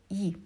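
yi, an unstressed ya shrunk to "yi"; reduced vowel. Shown as a demonstration. Teacher showing unstressed ya, yi as a reduced vowel. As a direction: say yi in place of a full "ya". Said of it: "we don't pronounce it fully".

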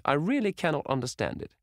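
put-on high, indignant old hostess; a lightly voiced scandalised old lady; exclaiming old lady. A narrator's reading. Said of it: "exclaimed the old lady".

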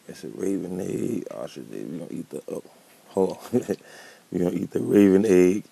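ordinary delivery

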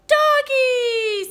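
put-on high, very loud, delighted toddler squeal; drawn-out baby exclamation. A narrator's reading. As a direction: squeal it like a baby spotting a dog. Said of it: "said Baby".

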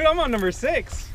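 声音高扬，得意地宣布已经第六条了; gleeful boast. Real, not acted. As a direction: crow about the tally, pitch way up. Counting catches, laughing after.

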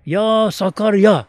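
slow, drawn-out loud call to the river; mother's cry. Retold or imitated. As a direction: cry out to the river, stretching each word. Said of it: "Bir bağırıyor".

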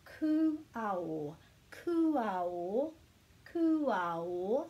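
"ku ao" drawn out, every vowel sounded; syllables joined together. Shown as a demonstration. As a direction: teach "ku ao" slowly, blending the pieces. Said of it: "just pronouncing each of them together".

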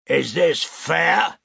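loud, slow, outraged demand; thundering. A narrator's reading. Weber thunders at Fenner in outrage. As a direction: Weber bellowing his protest. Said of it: "Weber thundered".

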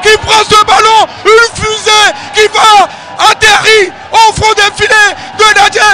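goal call, high and loud; excited sports commentary. Real, not acted. Goal scored, commentator erupts.

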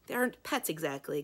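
usual speaking voice

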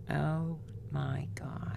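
breathless whisper, slow and shaken; stunned whisper. Real, not acted. Listener stunned by the caller's goodbye, whispering. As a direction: whisper it in disbelief.

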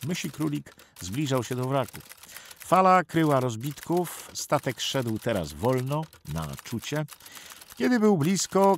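usual speaking voice

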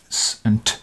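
s and t as bare consonant sounds, not letter names; unvoiced sound demo. Shown as a demonstration. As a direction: make a short "sss" and a clicked "t". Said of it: "sort of sounds".

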